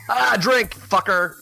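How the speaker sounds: shouting. Pretend. Hollering a drinking-game rule.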